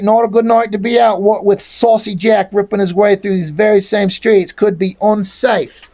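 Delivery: mock Cockney chatter; lightly hammed-up London street accent. Troll warning of Saucy Jack in the streets, mock London accent.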